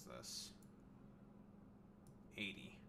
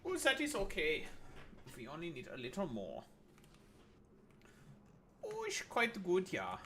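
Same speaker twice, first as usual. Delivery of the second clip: put-on camp German accent. Streamer fussing over sulfur supplies, camp German accent. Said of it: "doing the homosexual German accent".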